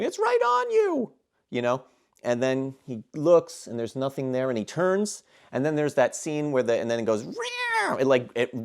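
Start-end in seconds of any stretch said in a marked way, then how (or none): 0.0-1.1 high-pitched imitated panic, not full volume; urgent warning in a pretend scream; mock screaming. Crew warning Dallas in the vents, mock scream. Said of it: "then they're screaming". 7.2-8.0 high screech imitating a movie monster; small, high mock alien shriek; little creature scream. Imitating the xenomorph as it appears, little scream. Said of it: "like, a little scream".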